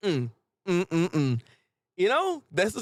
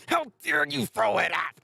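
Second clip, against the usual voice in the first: higher, mildly outraged imitation; mock upset; 4 semitones higher than usual. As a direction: play at being an offended cook. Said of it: "she's really upset".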